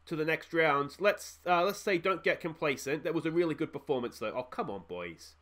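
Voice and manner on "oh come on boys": disappointed groan. Real, not acted. Disappointed at his team.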